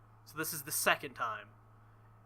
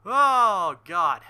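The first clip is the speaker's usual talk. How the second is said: loud laughter; 9 semitones higher, 18 dB louder than usual. Real, not acted. Bursts out laughing at game line.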